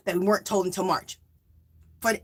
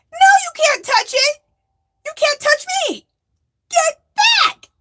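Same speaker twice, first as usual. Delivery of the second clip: much higher and louder, frantic no; panicked shout; 6 dB louder than usual. Retold or imitated. Refusing the stranger at the bank, high panicked yell. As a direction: yell it like you're backing away scared.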